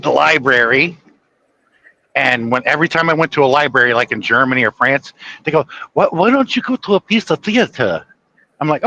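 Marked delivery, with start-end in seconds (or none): 5.9-8.0 higher-pitched, badly faked European accent; attempted European accent. European librarian suggesting the theater to a visitor, imitated European accent. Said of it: "I'm trying to do the accent".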